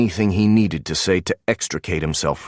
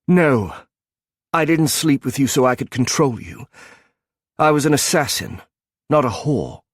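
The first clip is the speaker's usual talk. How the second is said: angry retort; 6 semitones higher than usual. Acted. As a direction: throw the insult back at him. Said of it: "Anger rose".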